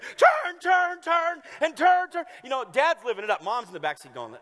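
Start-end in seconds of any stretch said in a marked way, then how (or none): 0.0-2.2 much higher, loud shouting "turn" over and over; excited dad yelling. Retold or imitated. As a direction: yell it like a thrilled dad at the wheel. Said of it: "he's like".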